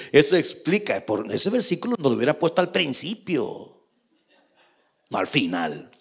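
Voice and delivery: ordinary delivery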